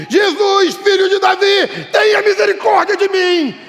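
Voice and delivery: very high, full-throated cry; shouted plea. Acted. Preacher showing believers how to cry to Jesus, shouted plea. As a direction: cry out at the top of your voice. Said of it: "Diga, grite".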